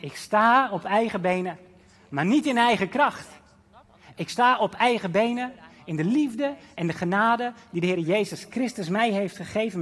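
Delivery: loud, ringing confession of faith; bold declaration. Acted. Believer declaring independence to the world, loud and proud. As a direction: proclaim it proudly, full voice. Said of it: "die jou laat zeggen".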